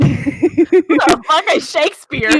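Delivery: loud, drawn-out disbelief; mock bafflement. Acting out high Dave hearing about Shakespeare, hammed-up bafflement. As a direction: play clueless and baffled, slow and loud.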